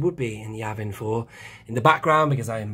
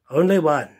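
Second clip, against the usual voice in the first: signature sign-off; 4 semitones higher, 6 dB louder than usual. Real, not acted. Channel sign-off catchphrase.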